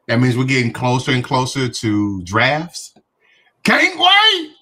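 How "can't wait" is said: put-on high, eager scout kid; Boy Scout voice. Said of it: "In my Boy Scout voice".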